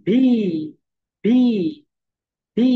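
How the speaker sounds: ভি with teeth on lip; letter name vi. Shown as a demonstration. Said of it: "ভি যখন উচ্চারণ করবে".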